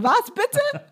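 high, laughing disbelief; shocked boyfriend. Retold or imitated. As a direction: squeal it in stunned disbelief. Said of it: "Er so".

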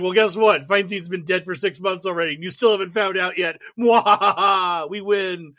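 gloating evil villain, laugh included; sneering panto villain. Pretend. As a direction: cackle it like a cartoon baddie. Gloating as deep state villain.

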